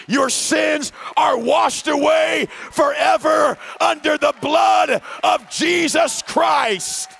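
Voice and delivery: slow, rising and swelling into a preaching shout; building preacher's shout. Retold or imitated. Pastor urging Tito before his baptism, building to a shout. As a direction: drive each line harder, slowing on "washed away forever".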